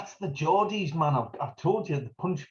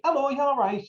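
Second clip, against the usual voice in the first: much higher, sing-song hello; cheery street greeting; 11 semitones higher, 3 dB louder than usual. Retold or imitated. Greeting a stranger in the street, sing-song and high. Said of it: "you wouldn't say to somebody in the street".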